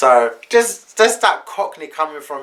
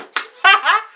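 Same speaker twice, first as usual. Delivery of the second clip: burst of laughter; 4 dB louder than usual. Real, not acted. Laughing at the memory.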